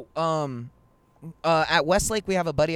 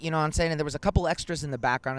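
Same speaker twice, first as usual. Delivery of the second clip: ordinary talk, nothing marked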